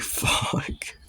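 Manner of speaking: whispered under the breath; whispered curse. Retold or imitated. Caught vaping by cousin, whispered panicked curse. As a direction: hiss it without voice.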